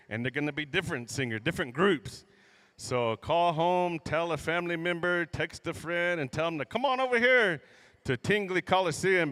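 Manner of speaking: ordinary delivery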